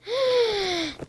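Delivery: startled high gasp; sharp gasp. Real, not acted. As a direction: gasp in shock. Finds scary chest contents.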